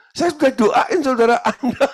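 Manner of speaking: high, loud retort breaking into laughter; acted protest. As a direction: protest into the phone, laughing at the end. Retells own protest on phone.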